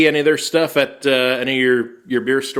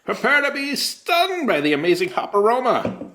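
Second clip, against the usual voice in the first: carnival barker voice; 11 semitones higher than usual. Acted. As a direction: sell it like a circus barker. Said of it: "That's my carnival barker voice".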